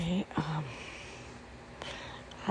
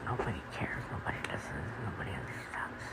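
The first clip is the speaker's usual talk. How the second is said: hushed, fading lower with each line; trailing whisper of hopelessness; despairing whisper; 7 semitones lower, 11 dB quieter than usual. Real, not acted. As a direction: whisper it as if nobody hears. Despairing private confession.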